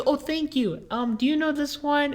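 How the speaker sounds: put-on high old lady voice; sweetly grateful old lady, pitched well up; old lady customer. Old lady thanks, then asks her daughter, high voice.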